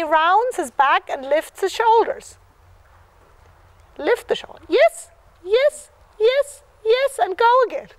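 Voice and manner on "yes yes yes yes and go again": excited cheering. Real, not acted. Coach cheering rider on.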